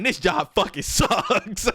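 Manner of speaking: laughing. Retold or imitated. Laughing while telling dad off.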